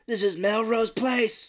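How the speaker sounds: high, slow, hammed-up declaration; mock melodrama. Retelling an actress's outburst, mock melodrama. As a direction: declaim it like an overplayed soap line. Acts out melodramatic movie line.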